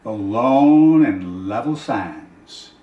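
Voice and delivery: very slow, drawn-out poetic close; poem recitation. Acted. Reader closing Shelley's poem on empty sands, slow and fading.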